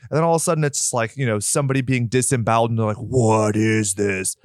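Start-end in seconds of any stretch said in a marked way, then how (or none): 3.0-4.4 very slow retold dismay; slow, drawn-out disbelief. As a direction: stretch each word in mock horror. Retold horrified reaction to footage.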